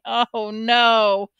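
loud, drawn-out wail of dismay; moaned. A narrator's reading. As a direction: let the moan swell. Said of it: "My mother moaned".

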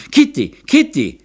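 high, loud calling of a name; worried cry. A narrator's reading. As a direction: call anxiously after someone running off. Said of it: "schrie in Sorge". (hohes, besorgtes Rufen)

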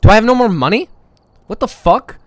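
loud, high indignant complaint; outraged. Real, not acted. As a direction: demand to know where the money went. Discovering money gone in game.

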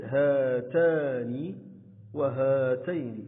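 هاتان and هاتين with the first "haa" held long; drawn-out long vowel. Shown as a demonstration. Teacher showing the retained madd letter, long vowels in هاتان, هاتين held out. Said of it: "حرف المد هنا لم يحذف".

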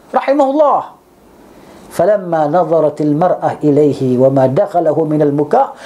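ordinary talk

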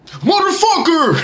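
drawn-out, high "Motherfucker!"; C-Town curse. Shown as a demonstration. Showing how the city says it, a drawn-out high curse. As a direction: stretch out "Motherfucker!" high and slow. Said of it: "That's exactly how you say it".

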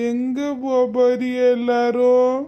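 put-on slow, drawn-out elderly voice; forgetful old relative. Forgetful elder asks the family where they are off to, slow and bewildered. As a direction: stretch it out like a confused old relative.

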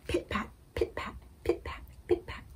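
soft whispered pit-pat of rain; whispered rain sound. Acted. Librarian giving the rain sound to kids, whispered. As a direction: breathe the raindrops out in a whisper.

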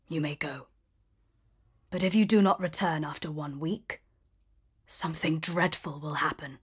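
the beast warns Belle, low and ominous. A narrator's reading. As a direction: let the threat hang, low and quiet.

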